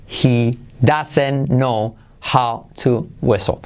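"He doesn't know how to whistle" slowly, each word separate. Shown as a demonstration. Word by word before linking. («He doesn't know how to whistle» lento, palabra por palabra)

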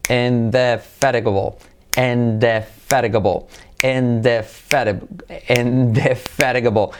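slow, segmented indefatigable, in-deh... FA-ri-guh-bul; slow repeat-after-me. Shown as a demonstration. Teacher asks learners to repeat with him, slow modelling. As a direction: stretch indefatigable out syllable by syllable. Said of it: "Repetid conmigo".

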